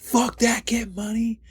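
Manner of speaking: high, strained imitation of a screaming crowd; mock crowd scream. Retelling a concert crowd's chant, mock scream. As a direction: yell it high, holding the volume back. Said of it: "the whole crowd just screaming".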